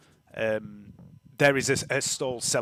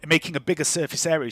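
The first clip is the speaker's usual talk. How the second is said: ordinary talk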